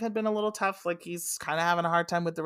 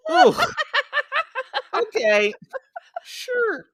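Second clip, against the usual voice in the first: skeptical sure; 6 dB louder, slower than usual. Retold or imitated. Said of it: "I'm like".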